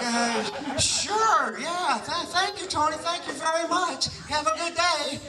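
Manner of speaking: high, giddy thank-yous tumbling out; thanking Tony, voice shooting up in disbelief; stunned excitement. Retold or imitated. Speaker thanking Tony on the phone, high and giddy. Stunned reply to Tony's offer.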